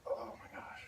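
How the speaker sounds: breathless whisper under strain. Real, not acted. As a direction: whisper it as the effort peaks. Straining through the exercise.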